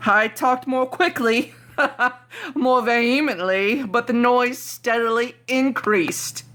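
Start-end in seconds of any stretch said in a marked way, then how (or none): none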